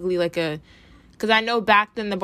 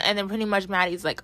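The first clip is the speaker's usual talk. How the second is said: ordinary talk, nothing marked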